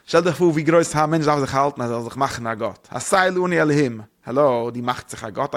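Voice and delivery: ordinary talk, nothing marked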